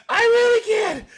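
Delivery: high, loud mock plea; worked-up comic outburst; gleeful pleading. Real, not acted. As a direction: beg loudly through your delight. Gleeful pleading after cracking up.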